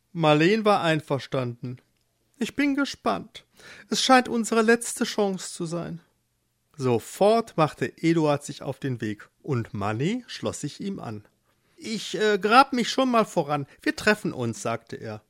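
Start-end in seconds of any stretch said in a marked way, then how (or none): 2.4-6.0 high female character voice; hopeful wife. Acted. Marleen sees a last chance, high hopeful voice. 11.8-14.6 higher-pitched animal voice; lightly voiced mole heading off; mole character. Mole digs ahead of Eduard, lightly voiced. Mole figure takes leave.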